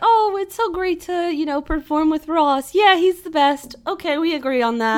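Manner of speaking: high, loud gushing chatter. Retold or imitated. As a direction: gush politely after a show. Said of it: "I'm always like".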